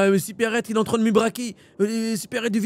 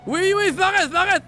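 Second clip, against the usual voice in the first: frantic shouting; 11 semitones higher than usual. Acted. Chaos during police chase.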